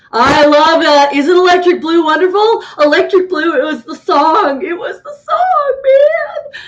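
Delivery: high, loud fangirl squeal; gushing excitement. Real, not acted. Gushing fan reacts to favorite song.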